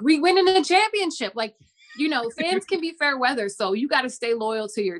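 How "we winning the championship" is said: high, overexcited fan boast, teasing; mocking fan hype. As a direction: squeal it like a cocky bandwagon fan. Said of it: "people are like".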